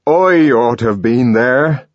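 high, loud impassioned plea; rising passionate lament; anguished outburst. A narrator's reading. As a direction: pour it out in desperation. Young man's anguished outburst.

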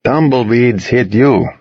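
slow, hammed-up bad Russian accent; a lightly done joke Russian accent; terrible Russian accent. Joking with friends in the car, slow bad Russian accent. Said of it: "doing a terrible accent".

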